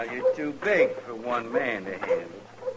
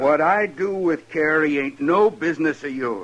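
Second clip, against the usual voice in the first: loud, angry back and forth; raised voices in a feud; heated quarrel; 3 semitones higher, 7 dB louder than usual. Acted. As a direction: throw the words at each other. Shouting match over Carrie.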